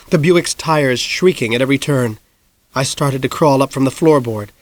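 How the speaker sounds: ordinary delivery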